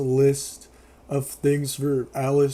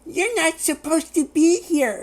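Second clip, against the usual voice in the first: high, put-on little-girl voice; mocking high-pitched child voice; 13 semitones higher than usual. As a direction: pitch it way up like a small kid. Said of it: "And she's like".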